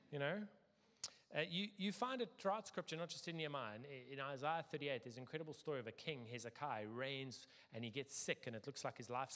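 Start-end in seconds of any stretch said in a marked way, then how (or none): none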